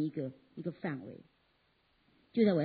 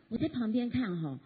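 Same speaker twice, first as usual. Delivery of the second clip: usual speaking voice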